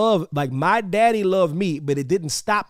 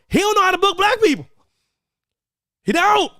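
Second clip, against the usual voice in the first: high-pitched, worked-up rant; exasperated rant; 9 semitones higher than usual. Real, not acted. Fan fed up with how Omos is booked, exasperated rant. Ranting about a wrestler's poor booking.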